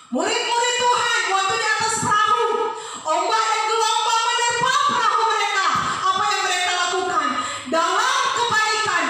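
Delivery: high, loud, fast sermon telling; preaching at full force. Acted. Pastor retelling the disciples in the storm, loud. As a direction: sweep them onto the storm-tossed boat.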